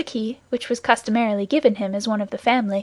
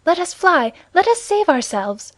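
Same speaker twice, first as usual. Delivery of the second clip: raised, urgent plea to flee; frightened woman urging escape; affrighted cry; 7 semitones higher, 4 dB louder than usual. A narrator's reading. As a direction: cry out in alarm, higher and louder. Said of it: "cried the affrighted Mme".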